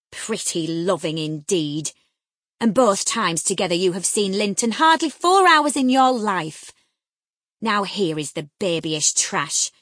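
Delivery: loud, mocking scorn; scornful mockery. A narrator's reading. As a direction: ridicule her childish love. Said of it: "as scornfully as I could".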